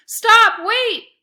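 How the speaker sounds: high, loud panicked cries; cried out. A narrator's reading. Boy crying out as the dog drags him across the grass, panicked and loud. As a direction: yell in panic while being dragged. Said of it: "I cried".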